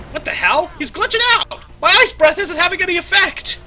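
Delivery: high, loud panicked cry; yelling in sudden alarm; alarmed shout. Real, not acted. Shock at glitching boss.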